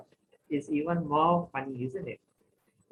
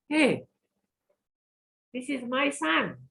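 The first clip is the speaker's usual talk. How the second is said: shocked realization; 9 semitones higher than usual. Acted. Lady sees her own son.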